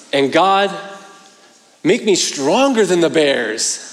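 put-on earnest little boy praying. Voicing son's earnest prayer.

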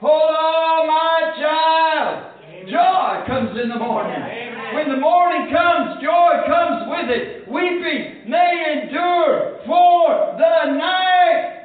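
high, drawn-out pulpit cry; exultant preaching. Real, not acted. Preacher promising joy in the morning, exultant cry. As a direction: cry it out, stretching the big words.

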